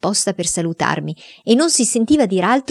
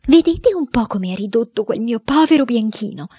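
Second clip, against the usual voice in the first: aunt complaining; 5 semitones higher than usual. Acted. Aunt complains about dog.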